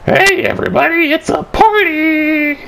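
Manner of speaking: very high, squealing party cheer; Ice King. Retold or imitated. Ice King greeting everyone after being expelled, squealed cheer. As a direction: draw it out in a silly high voice. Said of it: "Ice King's just sitting there like".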